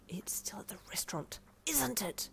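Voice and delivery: sharp whispered hiss. Acted. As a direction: hiss it under your breath. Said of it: "you hissed at him".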